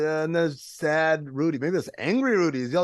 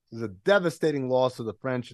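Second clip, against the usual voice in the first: ordinary talk, nothing marked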